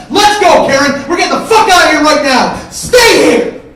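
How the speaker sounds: high-pitched, full-volume tirade; furious screaming rant. Retold or imitated. Mike jumping back from the fight, screaming at his brother in fury. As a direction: scream it as a final ultimatum.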